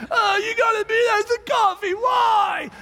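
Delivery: mock exasperated shout. Crazy tall adult yelling at child over coffee, exaggerated. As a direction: throw your hands up over the spilled cup. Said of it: "adult goes".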